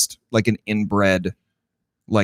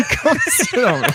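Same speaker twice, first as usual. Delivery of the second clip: laughing echo. Retold or imitated. Echoing the quote while laughing.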